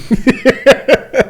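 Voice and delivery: high burst of laughter. Real, not acted. Laughing at the chat.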